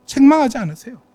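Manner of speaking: normal talking voice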